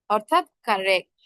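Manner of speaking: ordinary talk, nothing marked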